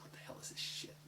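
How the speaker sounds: whispered disgusted reaction. Retold or imitated. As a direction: hiss it under your breath. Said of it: "and you're like".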